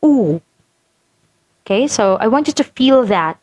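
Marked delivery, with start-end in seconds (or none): 0.0-0.4 long oo said by itself; an isolated, held-out oo; vowel demo. Shown as a demonstration. Teacher has students feel the tongue at the back, long oo. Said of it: "and your jaw is highest".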